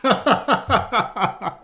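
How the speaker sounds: high squawking villain laugh; Penguin-style cackle. Pretend. Penguin laugh after Burgess Meredith.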